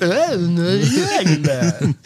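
slow mumbled Stallone voice; marbles in mouth. Retold or imitated. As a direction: slur it like Rocky. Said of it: "take the marbles out of your mouth".

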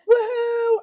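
high, loud whoop; cheering. Retold or imitated. Group cheers random news, high loud whoop. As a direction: cheer for anything. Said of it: "now we're cheering".

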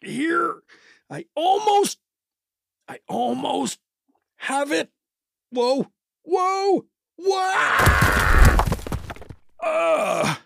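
high, strained grunting effort as he heaves and slips; high straining voice, the hold giving way into a cry; straining to lift. Acted. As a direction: heave with everything, then lose your grip. Straining to lift, then falling.

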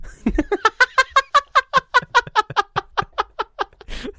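high, loud cackle that keeps going; high-pitched rolling laughter; long laughing fit. Real, not acted. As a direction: crack up helplessly, high and long. Dark joke about actor's death.

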